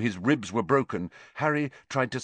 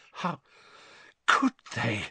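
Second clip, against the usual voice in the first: fighting back tears, words stuck in the throat; choked. A narrator's reading. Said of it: "she choked".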